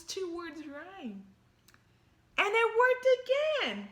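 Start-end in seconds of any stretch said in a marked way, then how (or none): 2.4-3.9 high, loud, fast triumph; excited. A narrator's reading. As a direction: burst out with joy. Triumphant narration.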